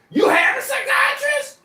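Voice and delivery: high, loud, slow incredulous yell; mock shouting receptionist. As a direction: yell it in disbelief. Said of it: "and she said".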